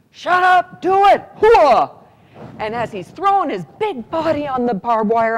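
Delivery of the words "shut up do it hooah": loud, slow gung-ho yell; shouted order. Retold or imitated. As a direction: bark it like a drill sergeant. Cadet barks order back.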